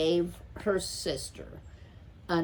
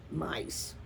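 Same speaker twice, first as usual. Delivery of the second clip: soft muttered aside to himself; murmured; 5 dB quieter than usual. A narrator's reading. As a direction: grumble it under your breath. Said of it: "murmured Erith to himself".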